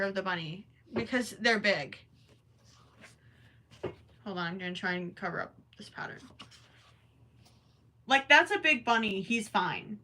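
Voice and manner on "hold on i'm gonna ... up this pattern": quick hushed whisper under the breath; whispered aside. Real, not acted. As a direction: whisper it fast to yourself. Muttering aside while working.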